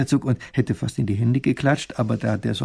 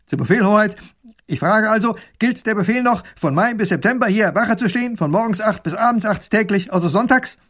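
put-on dutiful young soldier, higher and louder; 9 semitones higher, 5 dB louder than usual. Soldier reports proudly to the princess, eager and dutiful. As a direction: report eagerly like a loyal guard. Soldier asks duke formally.

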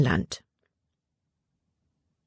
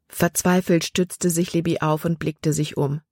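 ordinary delivery, nothing marked

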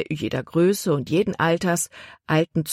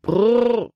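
calling the horses; 6 semitones higher than usual. A narrator's reading. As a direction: rein in the horses with a call. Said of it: "rief er". (lautes Brrr an die Pferde)

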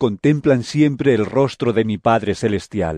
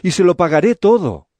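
raised-pitch servant's promise; imploring higher voice; pleading; 6 semitones higher, 4 dB louder than usual. A narrator's reading. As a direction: beg earnestly, pitch up. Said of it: "le rogó".

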